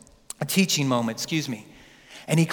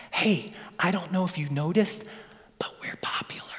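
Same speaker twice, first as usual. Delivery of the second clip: softer, then a hushed whisper; whispered aside; 9 dB quieter than usual. Retold or imitated. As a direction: lean in and whisper the secret. Peter whispers aside to Jesus.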